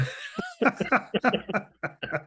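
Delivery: a high burst of real laughter. Real, not acted. Laughing at his own joke.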